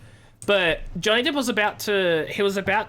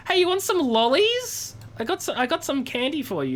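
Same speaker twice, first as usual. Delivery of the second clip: high, eager sing-out offering sweets; playful Johnny Depp; 9 semitones higher than usual. Retold or imitated. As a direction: offer a treat in a silly high voice. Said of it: "Johnny Depp's just like".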